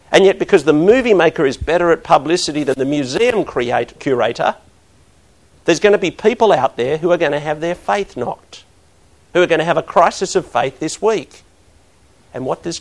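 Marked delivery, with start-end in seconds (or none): 0.1-11.4 louder, higher preacher's push; preaching at full force. Acted. Pastor warning the congregation about shaken faith, preaching hard. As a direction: drive it home to the congregation.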